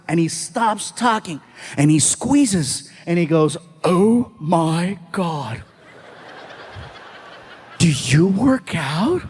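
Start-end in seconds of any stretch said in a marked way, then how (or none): 3.8-9.2 slow, low, impressed fan; awed admirer. Retold or imitated. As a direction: draw it out low, as if marvelling. Fan squeezes shoulder, awed.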